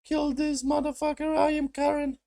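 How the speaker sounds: very high put-on mocking woman's voice; high joke voice mocking the villain; silly Karen voice. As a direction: squeak it out like a cartoon Karen. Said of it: "Stupid jokes with the Karen".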